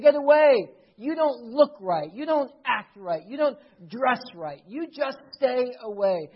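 loud, punched dismissal; scornful rejection. Acted. Insiders turning outsiders away from Jesus, rejecting. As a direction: drive them off, hitting each complaint hard. Acted rejection of those drawn near.

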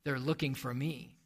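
put-on low, hushed child's secret; muffled giggle voice. Hiding boy replies to the boss, in a muffled, hushed voice. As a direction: confide it very softly, muffled. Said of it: "in a muffled giggle voice".

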